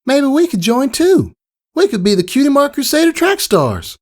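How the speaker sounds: high, excited little filly voice; a kid pitching a fun idea; bouncy young pony girl; Apple Bloom. Acted.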